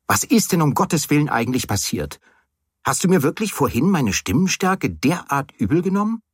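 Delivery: agitated, high-pitched exasperation; ziemlich laut. A narrator's reading. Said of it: "ich sprach schon wieder ziemlich laut". (aufgebracht, mit erhobener Stimme)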